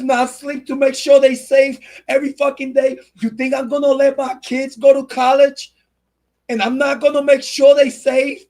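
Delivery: real angry outburst, pitched high; fired-up, high-pitched protective fury; angry rant. Real, not acted. Parent defending their kids from danger, angry.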